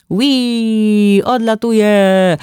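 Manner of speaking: put-on a kid launching his toy into flight; playful child call. A narrator's reading. Little Leoś calling out as he throws the Lego figure. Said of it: "zawołał Leoś".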